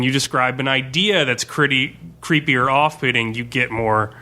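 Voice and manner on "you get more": normal talking voice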